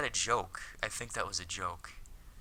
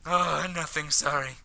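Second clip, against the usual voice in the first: put-on higher, hasty boyish apology; embarrassed schoolboy; 9 semitones higher, 9 dB louder than usual. Hikaru covers his slip to Akiko, higher sheepish voice. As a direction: brush it off, pitched up.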